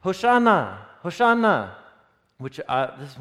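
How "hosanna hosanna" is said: loud, high cry of Hosanna; crowd shouting. Acted. The crowd shouting Hosanna as Jesus arrives, loud and high. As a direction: call it out like the cheering crowd. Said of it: "they were all shouting".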